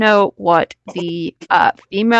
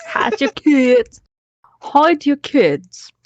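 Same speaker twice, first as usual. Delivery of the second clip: slow, higher mock English accent, a warning; British accent; 3 semitones higher, slower than usual. Friends joke about a meme, mock British accent. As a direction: drawl it out like a posh Brit. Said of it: "imagining that being said in an English accent".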